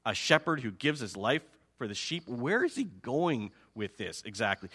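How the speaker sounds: low, grumbling disbelief; skeptical listeners. Acted. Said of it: "the listeners are probably thinking".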